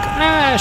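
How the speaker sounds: short, high, loud mock scream; sudden high-pitched "ah!" in retelling; freaked-out shriek. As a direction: imitate a startled freak-out yelp. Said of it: "She freaked out".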